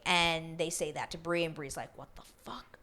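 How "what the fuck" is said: furious whisper, imitated; pissed. Bree hearing about the money, pissed whisper. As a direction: whisper it in mock outrage. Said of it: "And she's pissed".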